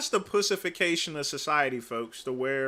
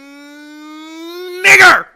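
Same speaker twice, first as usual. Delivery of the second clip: drawn-out build into a screaming yell, much higher and louder; demo shout; 10 semitones higher, 17 dB louder, much slower than usual. Shown as a demonstration. Streamer shows his viewers, building to a screaming yell. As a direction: belt it out as a screaming demonstration.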